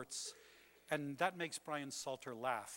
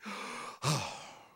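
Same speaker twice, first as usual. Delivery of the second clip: heaving gasp; 6 dB louder than usual. Shown as a demonstration. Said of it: "chest heavingly".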